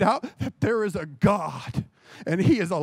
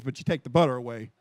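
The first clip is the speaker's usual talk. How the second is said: usual speaking voice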